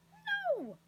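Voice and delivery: very high, loud refusal; indignant cry. A narrator's reading. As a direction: shoot the pitch right up in protest. Indignant refusal of a misheard offer.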